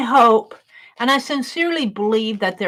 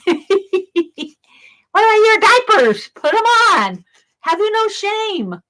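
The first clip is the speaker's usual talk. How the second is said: higher, louder hammed-up scold through laughter; mock scolding; 9 semitones higher, 4 dB louder, much slower than usual. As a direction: playfully tell off naked angels, laughing. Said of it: "I usually don't talk like that".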